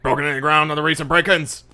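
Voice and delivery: loud, high, fast interrogation; shouting police captain. Acted. Captain presses detective on break-ins, angry shout. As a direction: hammer the subordinate with another question. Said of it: "Jackson comes up to you and goes".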